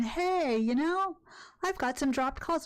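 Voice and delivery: high-pitched, casual mock of a caller; husband on the phone. Streamer retelling her husband's ill-timed call, mock husband voice. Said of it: "My husband's calling me going".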